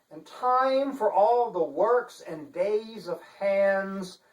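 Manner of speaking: slow, grand recitation with long pauses; dramatic poem reading. Shown as a demonstration. Author reciting T S Eliot to his viewers, slow and dramatic. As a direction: let every line fall with weight. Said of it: "a dramatic reading".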